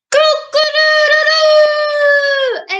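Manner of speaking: high, loud, slow rooster crow. Shown as a demonstration. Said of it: "can you do a cock-a-doodle with me".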